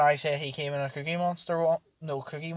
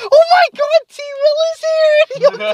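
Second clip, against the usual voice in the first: very high, loud mock squeal; excited drunk announcement; 14 dB louder, slower than usual. Forgetful drunk friend announcing T Will to everyone, mock excited squeal. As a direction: imitate a drunk friend shrieking the news. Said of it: "And he completely forgot".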